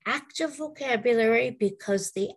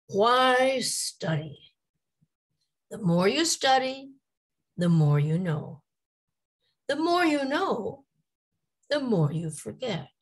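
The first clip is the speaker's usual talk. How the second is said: each phrase rising, then falling, ending with a high "So why study?"; playful dictation; 4 semitones higher than usual. Shown as a demonstration. Teacher dictating a joke to amuse students. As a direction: read it like a riddle that builds. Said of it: "This is a cute dictation".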